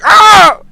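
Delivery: loud high-pitched cry of alarm; startled yell. Acted. Gnome crashing about in the back room, startled high yell. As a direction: yelp loudly after a crash.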